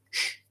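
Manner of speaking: conspiratorial shushing sound; playful hushing shh. Pretend. Host hushes viewers about his secret trip, playful shh. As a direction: shush them to keep a secret.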